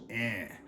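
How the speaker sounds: EN as one nasalized vowel; nasal vowel. Shown as a demonstration. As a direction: say en through the nose, N not fully sounded. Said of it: "It's spelled E N H".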